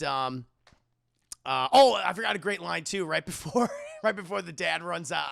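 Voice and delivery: normal talking voice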